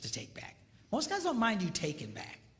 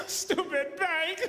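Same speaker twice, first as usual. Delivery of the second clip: laughing through a mock complaint, high and louder; mock whining with laughter; 11 semitones higher, 4 dB louder, slower than usual. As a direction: whine about the bank while cracking up. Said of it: "you don't go".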